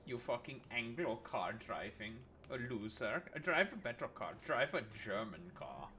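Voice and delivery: put-on German accent, mock offended; camp German accent. Streamer berating a viewer's Anglo car, mock-offended German accent. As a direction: scold in a hammed-up German accent.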